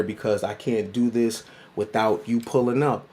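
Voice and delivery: ordinary delivery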